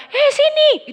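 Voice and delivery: high, loud summoning call; loud Batak call. Shown as a demonstration. Preacher calling a listener over, loud and high. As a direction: call someone over at full Batak volume. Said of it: "itu nggak marah".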